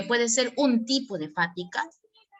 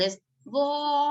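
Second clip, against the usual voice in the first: higher, stretched gol; gol with cachita; 5 semitones higher than usual. Shown as a demonstration. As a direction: give gol extra flair. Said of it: "hay que sacarle cachita".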